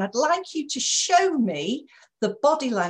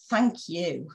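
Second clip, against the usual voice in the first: grudging thank you; 2 semitones lower than usual. Shown as a demonstration. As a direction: say thanks like you don't mean it. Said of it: "Different tone of voice conveys different messages".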